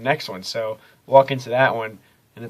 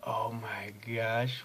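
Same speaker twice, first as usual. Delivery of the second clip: whispered slowly, no voice; hushed whisper; 10 dB quieter, much slower than usual. Retold or imitated. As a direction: breathe it out in a hushed gasp. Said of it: "and just like".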